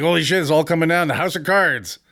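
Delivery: mock panic. Telling listeners how a bad bench workout feels, mock panic. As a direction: play the collapse as a half-joking alarm. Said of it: "I'm like".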